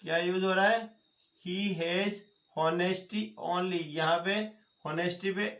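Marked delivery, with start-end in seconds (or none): 1.4-3.8 very slow, word by word; slow dictation. Shown as a demonstration. Teacher dictating to class, very slow and deliberate. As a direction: spell it out slowly for students copying.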